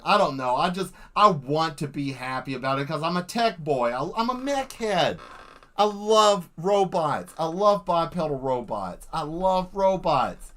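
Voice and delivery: ordinary talk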